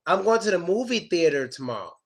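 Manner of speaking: normal talking voice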